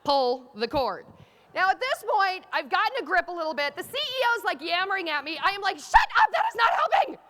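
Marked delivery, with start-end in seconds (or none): 0.0-1.0 low, deliberate PA instruction; PA announcer. Retold or imitated. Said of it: "comes back on the P A". 5.8-7.2 high, loud mock outburst; hammed-up angry shriek at a companion; exasperated yell. Acted snapping at the yammering CEO.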